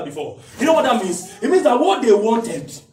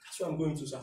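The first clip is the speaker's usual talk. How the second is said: usual speaking voice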